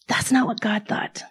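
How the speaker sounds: hushed, breathy whisper, leaning in close. Real, not acted. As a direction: drop to a whisper to land the point. Dramatic whisper making a point.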